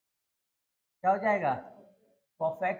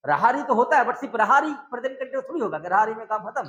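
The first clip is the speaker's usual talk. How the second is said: emphatic louder; 4 semitones higher, 8 dB louder than usual. Real, not acted. Teacher stresses key point loudly.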